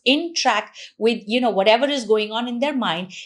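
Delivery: normal talking voice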